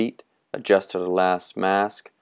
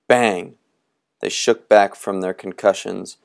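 ordinary delivery, nothing marked

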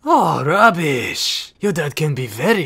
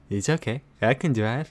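ordinary talk

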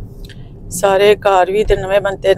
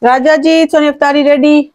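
raised, high call to someone away; 7 semitones higher, 3 dB louder than usual. Real, not acted. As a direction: call out across the house. Calling out to husband.

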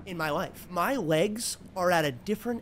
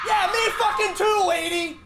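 shouting; 12 semitones higher, 6 dB louder than usual. Real, not acted. Shouting back after scare.

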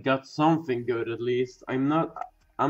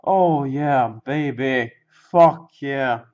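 loud, drawn-out hyped cheer; triumphant celebration; 2 semitones higher, 9 dB louder, slower than usual. Real, not acted. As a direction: celebrate loudly, relishing the win. Celebrates the rare card.

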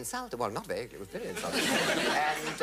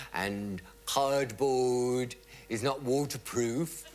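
slow deadpan punchline; much slower than usual. Retold or imitated. Sketch punchline drawn out.

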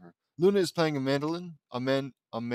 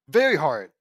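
loud emphatic exclamation; exclaiming; 10 dB louder than usual. A narrator's reading. Annoyed sister complaining at the meeting, loud exclamation. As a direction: blurt it out, much louder. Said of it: "exclaims Laney".

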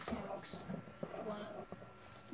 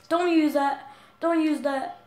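loud, higher warning shout; 5 semitones higher, 11 dB louder than usual. Real, not acted. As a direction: yell at him to stop. Shouting at attacker.

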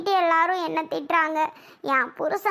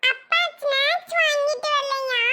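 put-on high-pitched child asking hopefully; questioning little child; 8 semitones higher than usual. Child asking father about snacks, hopeful. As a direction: ask as a small child would.

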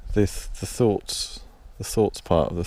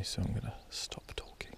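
whispering; 3 semitones higher, 14 dB quieter than usual. Real, not acted. Someone walking past, speaker hushes.

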